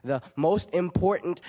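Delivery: rhythmic slam delivery, swelling and easing; slam poetry. Acted. Young poet performs his poem about poetry, slam poetry. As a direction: perform it as a driving spoken-word piece.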